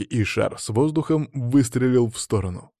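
ordinary delivery, nothing marked

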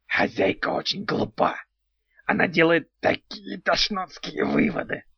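sincerely impressed goblin voice; admiring goblin. A narrator's reading. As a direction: goblin praising as an insult. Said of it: "искренне восхитился Антигон".

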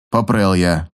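ordinary delivery

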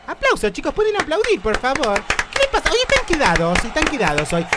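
high, loud hype for the audience; over-the-top talk show host; hyped host. Acted. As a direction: whip up the crowd. Host demanding applause.

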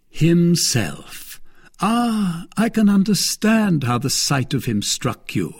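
a hint of a smug lord; Lord de Winter. Lord de Winter taunting his captive sister-in-law, lightly voiced.

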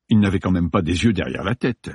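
ordinary delivery

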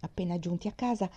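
ordinary delivery

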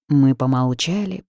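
ordinary delivery

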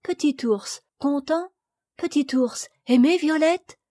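little Violette promising to love the bear; little girl voice. A narrator's reading. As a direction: talk like a tiny princess. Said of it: "little girl voice".

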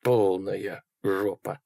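slow, drawn-out resignation; slow, sinking realisation; grim inner thought. A narrator's reading. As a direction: stretch it out, thinking to yourself. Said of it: "подумал он".

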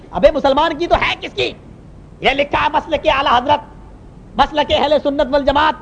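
high, insistent proclamation; imitating irate imam. Imam tells outsider whose mosque it is, high angry declaiming. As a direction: declare each title as a rebuke.

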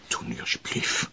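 begging whisper. Acted. Father begging son.

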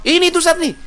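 high, loud complaint from the first word; acted heated outburst. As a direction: burst out with a grievance. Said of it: "Udah intonasi tinggi".

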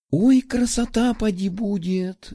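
high, dreamy and drawn out; dreamy drawl. A narrator's reading. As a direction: sigh it out dreamily. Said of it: "мечтательно протянула Любава".

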